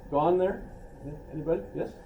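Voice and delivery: ordinary delivery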